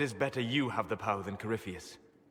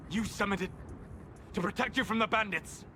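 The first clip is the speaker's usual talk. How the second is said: angry accusation; 8 semitones higher than usual. Acted. Confronts mage over bound spirit.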